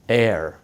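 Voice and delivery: "Air" with a strong R at the end; American air, clear final R; American rhotic air. Shown as a demonstration. American husband demonstrates his accent, strong final R. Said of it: "pronounce the R sound strongly".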